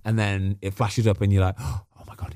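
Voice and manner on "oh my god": an acted, breath-only gasp of surprise; mock a stunned whisper; hushed shock. Player reacting to the team sheet, hushed shock.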